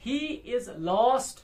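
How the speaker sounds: He is lost slowly, weight on lost; light "He is", then a strong "lost"; stress on LOST. Shown as a demonstration. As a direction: lean on the last word of He is lost. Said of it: "last है ना".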